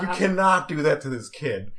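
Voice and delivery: high, loud scolding through laughter; laughing scold. Retold or imitated. Scolding coworker while laughing, high and loud. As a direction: scold him while cracking up.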